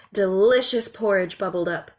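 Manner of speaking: plain reading voice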